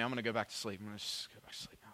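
trailing off into a near-whisper; sleepy mumble. Retold or imitated. Dozing dad talks himself back to sleep, fading mumble.

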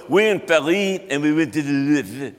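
hammed-up French accent; bad movie-French accent. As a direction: tell it like a cartoon Frenchman. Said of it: "my French accent came from".

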